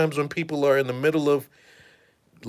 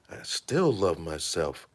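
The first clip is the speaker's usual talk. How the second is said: lower, softer and slow, half to himself; low hushed musing; 8 semitones lower, 7 dB quieter, slower than usual. Retold or imitated. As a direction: murmur it into the mirror, mock-serious. Thief at mirror, lower voice.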